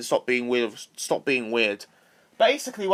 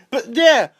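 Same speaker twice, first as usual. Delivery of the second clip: panting after a long run-on; words pushed out breathlessly; out of breath; 10 semitones higher, 7 dB louder than usual. Real, not acted. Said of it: "Out of breath".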